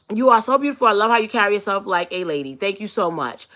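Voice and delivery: usual speaking voice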